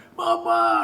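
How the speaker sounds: high, drawn-out wail for daddy; mock child's plaintive cry; imitated child crying out. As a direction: call out like a little boy crying at the door. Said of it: "nangis di pinggir pintu".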